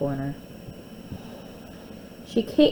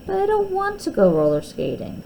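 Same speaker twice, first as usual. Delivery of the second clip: whiny protest; 12 semitones higher than usual. Acted. Molly protests to Catherine.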